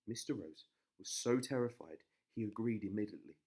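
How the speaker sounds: plain reading voice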